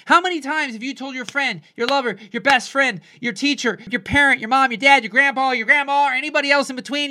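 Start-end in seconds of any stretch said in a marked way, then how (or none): none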